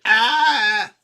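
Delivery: high, loud mock scream; sudden shrill-free burst of a hero's scream. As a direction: scream like a man under the laser. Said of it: "You hear Craig screaming".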